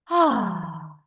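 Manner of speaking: long audible out-breath sigh; demo sigh. Shown as a demonstration. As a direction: breathe it all out in one sigh. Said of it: "한숨 쉬게 하시고". (길게 내쉬는 한숨)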